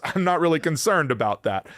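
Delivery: ordinary talk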